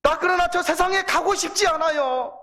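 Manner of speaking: put-on pleading child. Child begs to stay in heaven.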